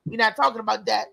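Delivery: usual speaking voice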